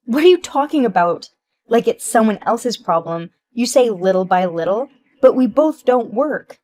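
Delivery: loud, indignant snapping back; angry retort. Acted. Wife shouting at her friend who says pay it back. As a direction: refuse the blame loudly.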